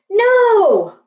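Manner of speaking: high, loud desperate cry; cried out. A narrator's reading. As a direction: shout to stop the danger. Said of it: "cried Annie".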